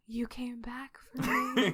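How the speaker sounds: slow, breathless whisper; hushed disbelief at being rescued; awed whisper. Retold or imitated. Peter moved after rescue.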